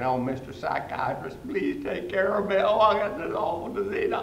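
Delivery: high, whiny pleading voice; mocking patients begging the psychiatrist. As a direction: grovel theatrically to the doctor. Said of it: "getting down on our knees and begging".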